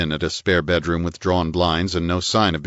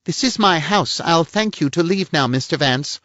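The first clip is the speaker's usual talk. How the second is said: a higher-voiced prim society lady; lady's voice, pitched up and a little pert; voiced woman; 10 semitones higher than usual. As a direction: play the indignant lady of the house. Woman figure's lines.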